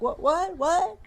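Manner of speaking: high, rising startled voice; a sleepy horse voiced high and alarmed. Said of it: "Horse is like".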